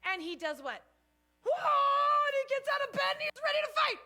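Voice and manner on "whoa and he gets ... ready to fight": high, loud, fast roar of waking alarm; startled battle shout. Retold or imitated. Samson jolted awake by the alarm, shouting high and fast. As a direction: burst out of bed ready to fight.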